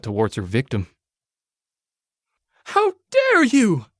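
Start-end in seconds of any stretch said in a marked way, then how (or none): 2.6-3.9 slow, very high indignant cry; high, drawn-out outraged rabbit girl; small-voiced shout. A narrator's reading. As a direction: shout as much as a tiny voice can. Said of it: "she shouted, as much as her voice could shout".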